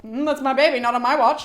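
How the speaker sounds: loud, firm mock-protective retort; protective mother. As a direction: act out a mother stepping in. Aphrodite swooping in to rescue son.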